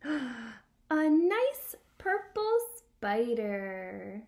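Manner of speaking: slow, bright storybook reading with gasps; reading to a toddler. Acted. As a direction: read it to a small child full of wonder. Reading picture book to child.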